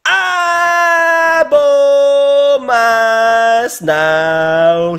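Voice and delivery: Abomasnow stretched out long and shouted high; slow, high, loud announcer yell; drawn-out shout. Real, not acted. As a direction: bellow the name like an announcer, dragging every syllable. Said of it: "Je sais pas pourquoi j'ai dit ça comme ça".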